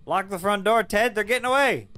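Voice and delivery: high, loud shout across the house; calling from far away. Real, not acted. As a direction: yell to someone rooms away. Yelling at ghost across house.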